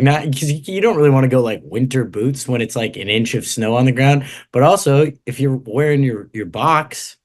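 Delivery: ordinary talk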